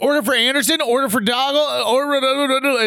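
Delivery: high-pitched pestering pile-up of voices; impatient crowd mimicry, trailing into gibberish; clamoring delivery drivers. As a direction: call out orders over each other, pitched high. Dashers shoving phones, clamoring at manager.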